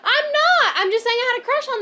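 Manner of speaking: high, loud indignant denial; outraged yelp; defensive protest. Real, not acted. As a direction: refuse the accusation outright.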